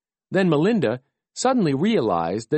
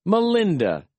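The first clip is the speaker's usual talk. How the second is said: mildly excited, higher-pitched call; lightly voiced happy frog, pitched up; happy croak; 6 semitones higher than usual. A narrator's reading. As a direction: greet an old friend with a touch of delight. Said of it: "Melvin croaked happily".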